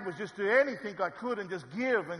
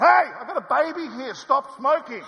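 loud, higher yell across the carpark; shouting at smoker; 7 semitones higher, 12 dB louder than usual. Retold or imitated. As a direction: bellow at the smoker to stop. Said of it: "And I went".

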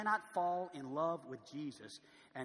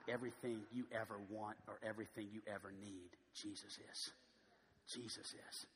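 hushed; 6 semitones lower, 8 dB quieter than usual. Real, not acted. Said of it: "soft closing".

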